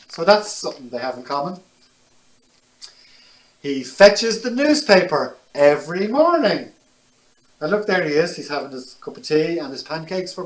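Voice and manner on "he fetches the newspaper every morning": put-on lively, animated read to children; picture book reading. A narrator's reading. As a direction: tell it brightly to little listeners.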